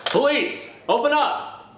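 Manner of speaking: high, loud call through the door; police shout. Acted. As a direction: bark it like cops at the door.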